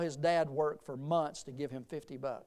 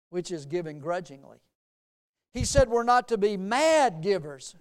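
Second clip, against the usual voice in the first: loud, raised preaching voice; emphatic preaching; 3 semitones higher, 7 dB louder than usual. Real, not acted. Preacher teaching the church about givers, emphatic preaching. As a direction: drive each point home to the congregation.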